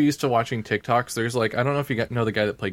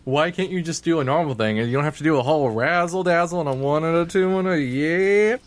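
exasperated; 6 semitones higher, 3 dB louder than usual. Retold or imitated. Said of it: "I'm just like".

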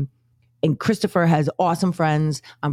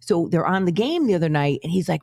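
ordinary talk

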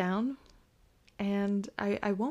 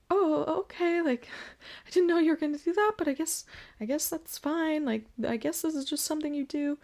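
mock surprised; 8 semitones higher, faster than usual. Retelling her own reaction.

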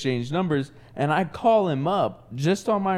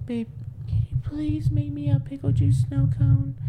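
put-on high, pleading baby voice; whiny girlfriend voice; 10 semitones higher than usual. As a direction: beg sweetly in a high voice. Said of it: "And I'm like".

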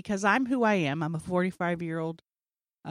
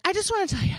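much higher, breathy-seeming start; nervous fan gush; 11 semitones higher, faster than usual. Retold or imitated. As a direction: blurt it out starstruck. She gushes nervously at comedian.

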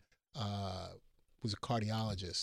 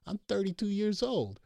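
incredulous protest; 10 semitones higher than usual. Retold or imitated. Protesting to mom.

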